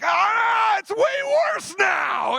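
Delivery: very high, loud drawn-out wail; frustrated scream. Retold or imitated. After slamming the door broke the deadbolt, frustrated scream. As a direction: scream it slow and high, at breaking point.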